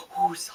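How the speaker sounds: sharp high gasp into a squeaky "Who's". Shown as a demonstration. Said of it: "you go like this".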